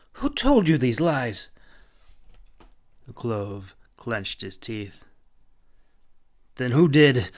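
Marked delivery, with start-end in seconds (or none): 0.1-1.5 higher, louder indignant question; fairy rejecting the accusation, indignant; indignant denial. A narrator's reading. 6.5-7.3 loud, forceful demand; man pressing the fairy for answers, loud. A narrator's reading. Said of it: "he demanded".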